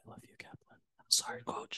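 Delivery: soft whispered apology; whispering. Real, not acted. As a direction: whisper it to the coach, barely voiced. Players whispering apology to coach.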